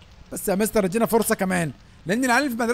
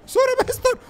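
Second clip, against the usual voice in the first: startled yell; 11 semitones higher, 8 dB louder than usual. Real, not acted. Hit someone, yelling.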